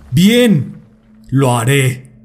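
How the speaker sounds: furious snap that sinks to resignation; angry but resigned. Acted. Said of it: "espetó iracundo, pero resignado".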